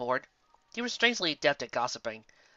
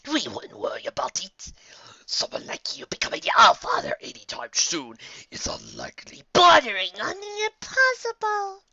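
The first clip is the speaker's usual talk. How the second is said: put-on breathy, hushed old entity; whispered voice of the ancient Allfather; whispery ancient Allfather; 8 semitones higher, 7 dB louder, slower than usual. A narrator's reading. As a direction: speak like an ancient creature from the shadows.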